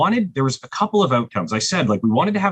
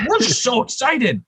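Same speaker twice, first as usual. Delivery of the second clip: high mock excitement; 12 semitones higher than usual. Bassist quoted.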